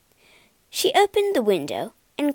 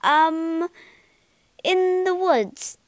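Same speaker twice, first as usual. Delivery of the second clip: hesitant lie; 6 semitones higher, slower than usual. A narrator's reading. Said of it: "lied the puppet".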